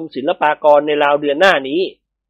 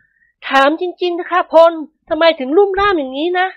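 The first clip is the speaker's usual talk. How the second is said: much higher, slow female character voice; questioning wife; 12 semitones higher than usual. A narrator's reading. Nantha asks her husband about his accident, high questioning wife. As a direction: ask in a high, drawn-out wifely voice.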